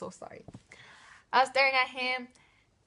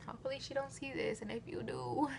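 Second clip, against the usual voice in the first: 1 whispered aside; 4 semitones higher, 8 dB quieter, faster than usual. Real, not acted. Aside hoping classmate won't watch.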